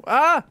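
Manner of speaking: sharp high yelp; startled yelp. Real, not acted. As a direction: cry out as you slip. Slipping on ice.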